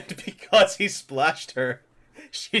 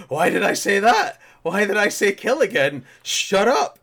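loud, raised-pitch mock outburst; hammed-up panic at your own slip; flustered self-scolding; 4 semitones higher, 5 dB louder than usual. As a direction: berate yourself in exasperation.

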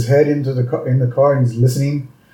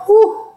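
high-pitched mock sigh of relief; relieved whew. Worker relieved at boss's friendly hello, exaggerated high whew.

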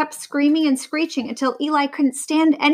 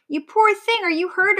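a hint of fussing old birds, higher; fussy birds; 9 semitones higher than usual. The fretting birds fuss over Vera, higher. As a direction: fret over her like a worried hen. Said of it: "they cried".

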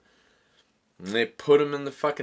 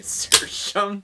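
ordinary delivery

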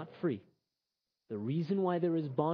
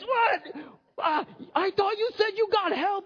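high, incredulous squeal; disbelieving, he swore he got help; stunned bartender; 14 semitones higher, slower than usual. Acted. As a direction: bartender splashed once more, high and incredulous. Bartender splashed a third time.

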